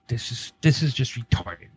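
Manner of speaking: usual speaking voice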